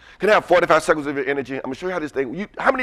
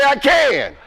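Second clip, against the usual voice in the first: rallying shout; 9 semitones higher than usual. Shown as a demonstration. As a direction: belt it out for them to repeat.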